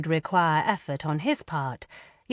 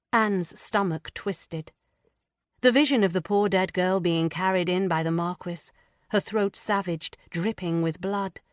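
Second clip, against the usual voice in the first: plain reading voice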